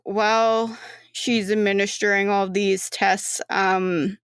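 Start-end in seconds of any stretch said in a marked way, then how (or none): none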